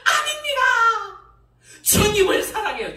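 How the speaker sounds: high, loud crying protest; tearful shout. Retold or imitated. Preacher reenacting pleading with God, shouting through tears.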